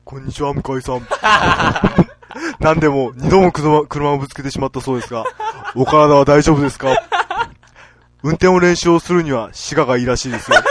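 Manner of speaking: loud, suave lady-killer read; seductive letter reading. Acted. Reading a listener's letter in a smooth seductive voice.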